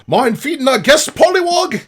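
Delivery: high, loud call to someone nearby. Acted. Rogue asks frog to feed guest, calling out.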